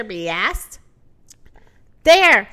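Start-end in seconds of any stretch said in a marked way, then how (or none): 2.0-2.5 loud, urgent rooster call; shout. A narrator's reading. Rooster alerting the farm animals, shouting. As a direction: call it out in a burst of volume. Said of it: "shouted the rooster".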